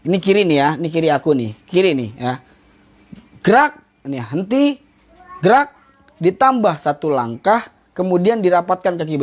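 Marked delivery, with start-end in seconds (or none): 3.5-5.7 crisp parade command; drill command. Shown as a demonstration. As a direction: bark the drill order to the troops. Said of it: "demonstrating command".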